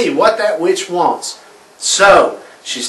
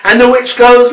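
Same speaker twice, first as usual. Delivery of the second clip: usual speaking voice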